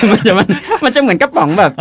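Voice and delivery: real laughter breaking up the words, high pitch; laughing. Real, not acted. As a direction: stammer it out through giggles. Cracking up over tin-can robots.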